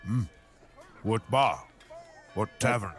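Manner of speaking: ordinary delivery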